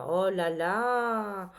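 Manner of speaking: dismayed oh là là. Real, not acted. Tarot reader shocked by cards.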